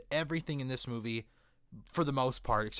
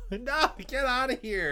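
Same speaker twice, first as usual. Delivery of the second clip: mock outraged Magneto; 9 semitones higher than usual. Mock rejection in character.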